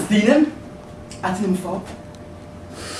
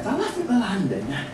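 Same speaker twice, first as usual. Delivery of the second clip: ordinary talk, nothing marked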